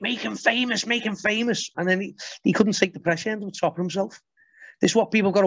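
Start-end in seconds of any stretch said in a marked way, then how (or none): 0.0-1.7 higher, taunting repeated chant; mocking online crowd. Mimicking online crowd egging each other on, higher taunting chant. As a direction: gloat like a pile-on mob. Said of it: "everyone's like".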